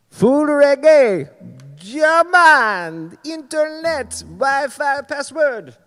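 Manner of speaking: over-the-top bad German accent, dragging the words; terrible accent. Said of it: "that's a terrible accent".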